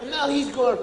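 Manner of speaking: ordinary talk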